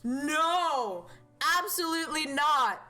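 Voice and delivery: loud, high, drawn-out mock protest; emphatic refusal. Streamer refusing to do voices for chat, shouted protest. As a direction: shout it down with exaggerated outrage.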